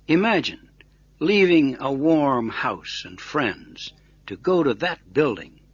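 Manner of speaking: usual speaking voice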